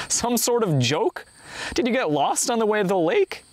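indignant scolding, pitched high then falling; storyteller's contained yell at a partner; narrated yell. A narrator's reading. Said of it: "yelled the second man".

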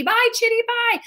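high sing-out farewell; high, drawn stage goodbye; calling goodbye. Retold or imitated. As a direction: wave off with a high-pitched call. Re-enacting waving goodbye on stage.

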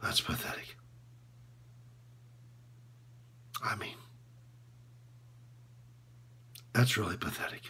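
very low, barely voiced near-whisper; drained, whispery self-disgust; ashamed confession. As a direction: confess it in hushed fragments with long pauses. Coach's ashamed confession of cheating.

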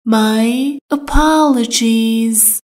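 "My apologies" stretched out slowly syllable by syllable; slow it right down for learners; slow, careful. Shown as a demonstration. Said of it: "teacher repeats slowly". (آهسته و شمرده)

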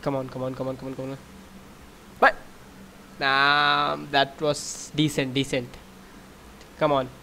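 ordinary talk, nothing marked

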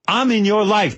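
high, mock-insistent Benji; cheeky Benji. Benji telling Tracy he's in his life, high and insistent. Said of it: "He goes".

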